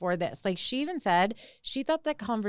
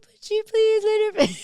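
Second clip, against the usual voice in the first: much higher, louder, put-on exasperated plea; mock pleading; 13 semitones higher, 8 dB louder than usual. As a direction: whine it like a fed-up friend defending her. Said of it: "I'm like".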